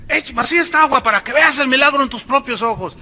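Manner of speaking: high, loud stage-preacher command; mock showman preacher. Video preacher ordering the water poured out, high and loud. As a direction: sell the trick to the crowd.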